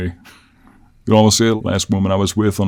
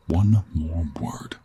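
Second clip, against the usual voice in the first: very slow, husky half-whispered threat; slow menacing warning; 3 semitones lower, much slower than usual. Acted. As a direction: space out each word as a threat. Repeats the warning to captive.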